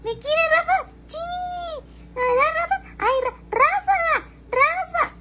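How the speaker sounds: mocking impression of the lady. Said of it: "como se burlan de".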